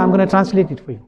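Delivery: usual speaking voice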